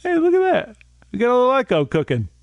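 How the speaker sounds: high, fast delighted exclaim; thrilled by the new echo; excited. Real, not acted. Discovering the echo effect.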